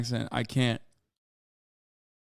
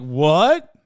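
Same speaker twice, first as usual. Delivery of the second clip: baffled what; 7 dB louder than usual. Retold or imitated. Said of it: "They're like".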